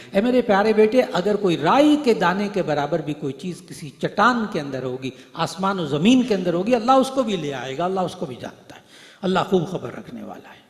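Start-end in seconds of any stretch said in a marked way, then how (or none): 0.1-8.8 fervent preaching. Acted. Preacher translates Luqman's verse fervently.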